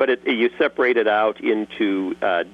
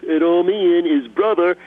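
higher, louder Cockney brother Ian; Cockney accent; 8 semitones higher, 4 dB louder than usual. Shown as a demonstration. Voice actor switching to the second Cockney brother. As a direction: answer back as the other Cockney brother.

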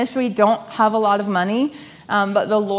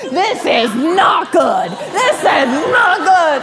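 slow, louder, laughing through it; laughing mock panic; 7 semitones higher, 6 dB louder, slower than usual. Husband Jake walking round the house, laughing mock panic. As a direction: repeat it like a dread mantra while laughing.

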